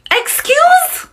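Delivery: high, rising indignant query; mock offence. Caribbean speaker asked about being Jamaican, high-pitched offence. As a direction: shoot the pitch way up, affronted.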